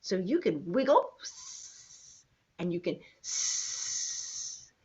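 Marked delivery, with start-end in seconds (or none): 3.2-4.7 snake hiss. Shown as a demonstration. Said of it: "you're being a snake".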